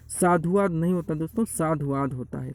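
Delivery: ordinary talk, nothing marked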